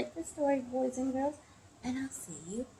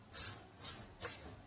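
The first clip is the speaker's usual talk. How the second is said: loud voiceless hisses; monkey hissing; 8 dB louder, slower than usual. A narrator's reading. Monkeys copying the angry peddler, hissing. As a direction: hiss hard in separate bursts. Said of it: "what do you think they said".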